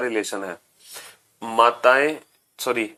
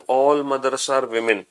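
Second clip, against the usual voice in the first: slow dictation; much slower than usual. Shown as a demonstration. Teacher dictating English rule.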